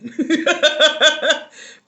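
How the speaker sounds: loud, high burst of laughter; laughing. Real, not acted. Host admits she barely made it today, real loud laughing. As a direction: crack up loudly.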